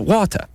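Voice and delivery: WATER ending in an A, "wah-tah"; imitated English pronunciation. Host imitates English girlfriend's word, imitated English pronunciation. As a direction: drop the R of water for an "ah". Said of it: "toss an A at the end".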